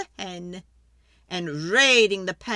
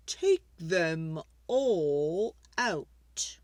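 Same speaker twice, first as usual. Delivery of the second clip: put-on slow, breathy old man's whisper; whispered advice; 3 semitones lower, much slower than usual. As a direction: whisper it slowly like a secret. Wise man whispers advice.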